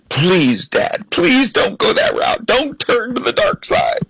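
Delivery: high-pitched imitation of pleading kids; mock begging. Kids begging dad about his Trump act, mock pleading. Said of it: "they're begging me".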